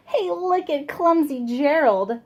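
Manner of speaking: mocking jeer at a clumsy friend; sneering. Animals taunting Gerald on the dance floor, sneering. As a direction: jeer at him, teasing. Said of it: "the animals all sneered".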